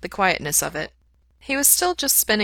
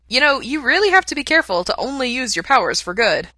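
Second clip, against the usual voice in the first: higher, louder playful teasing; 7 semitones higher, 5 dB louder than usual. A narrator's reading. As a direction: tease him playfully. Pete teasing Mikey.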